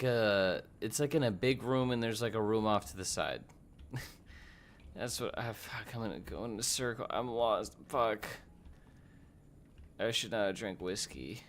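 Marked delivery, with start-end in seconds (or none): none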